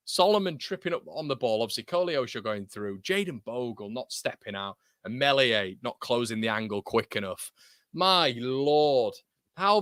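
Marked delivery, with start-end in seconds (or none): none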